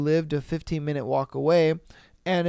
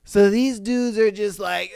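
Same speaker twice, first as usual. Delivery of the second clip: ordinary talk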